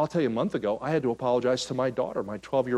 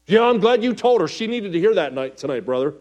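mock congregant; 6 semitones higher, 7 dB louder than usual. Congregant reply voiced by preacher.

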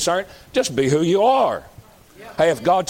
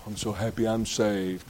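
soft contented; 7 semitones lower, 9 dB quieter than usual. Shown as a demonstration. Quiet demo of joy.

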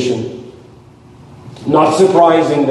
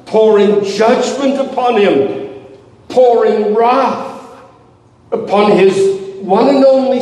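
higher, intense preacher's plea; impassioned preaching; 6 semitones higher than usual. Acted. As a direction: press the question on the congregation. Preaching at full force.

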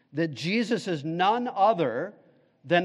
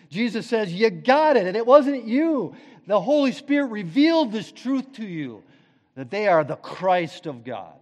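higher, louder, fervent preaching; full-force preaching; 4 semitones higher, 7 dB louder than usual. Acted. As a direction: proclaim it to the congregation.

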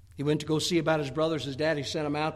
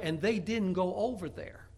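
usual speaking voice

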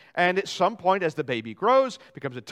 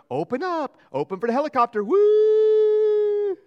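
high, loud singsong airplane-spoon coaxing; playful baby-feeding voice, rising to a loud high whoop; mom feeding a baby; 9 dB louder, slower than usual. Retold or imitated. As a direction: coax a toddler to open wide, ending in a squealing woo. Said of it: "you can imagine Miss Marisa going".

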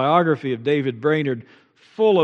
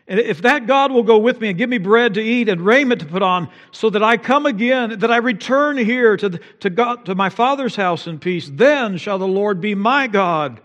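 rising, louder and higher, pleading vow; fervent vow; 6 semitones higher, 5 dB louder than usual. Acted. Preacher voicing Jacob's vow to God, fervent and loud. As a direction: cry out the vow with mounting fervor.

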